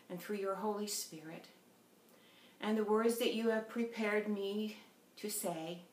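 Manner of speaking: soft, hushed prayer voice; earnest hushed prayer. Acted. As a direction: pray quietly and reverently. Opening prayer before the sermon.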